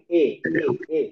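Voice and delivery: এ repeated, midway between আ and ই; imala vowel. Shown as a demonstration. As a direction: say এ several times, neither a nor i. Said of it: "এভাবে".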